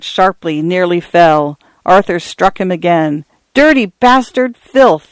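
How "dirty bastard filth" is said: high, angry outburst; raised-pitch cry of disgust; furious cry. A narrator's reading. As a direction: spit out insults while striking. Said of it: "Arthur cried".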